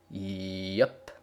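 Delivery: a lightly voiced, terse stallion; laconic yup. A narrator's reading. Big Macintosh chuckling along with his sister, terse.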